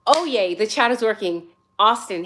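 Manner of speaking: usual speaking voice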